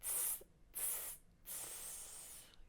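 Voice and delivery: teasing monkey "tss" hisses; three soft voiceless hisses, last one drawn out; monkey chatter. A narrator's reading. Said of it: "stamped their feet back at him and said".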